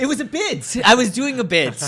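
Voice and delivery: high, loud protest through laughter; protesting loudly, half laughing. Real, not acted. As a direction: defend the joke while cracking up.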